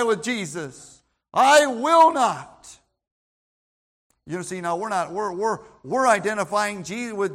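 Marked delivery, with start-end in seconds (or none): 1.3-2.8 slow, loud "I will not", each word hit; emphatic. Shown as a demonstration. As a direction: stress "will" hard and slowly. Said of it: "We're emphatic about it".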